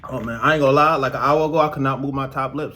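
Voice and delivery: ordinary delivery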